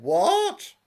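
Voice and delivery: very high, loud and laughing; comic high yelp; exasperated dad. Acted. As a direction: squeak it out in mock irritation. Father answers daughter, comic.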